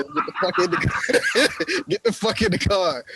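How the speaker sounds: half-laughing mock yell; urgent order. Player yells to Abby, laughing.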